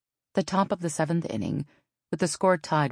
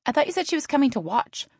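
sister voice; 12 semitones higher, 4 dB louder, faster than usual. A narrator's reading. Sister voiced, higher pitch.

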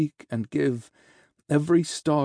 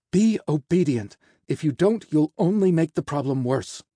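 loud, higher-pitched reprimand; stern scolding; 4 semitones higher, 5 dB louder than usual. Acted. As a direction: order a difficult patient to obey. Healer scolds the resisting angel.

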